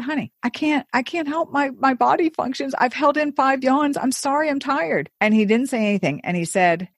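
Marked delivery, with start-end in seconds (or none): 0.0-5.1 high-pitched, exasperated plea; helpless apology, voice held high; pleading. Retold or imitated. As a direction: plead your case to an annoyed partner. Retells pleading with angry boyfriend.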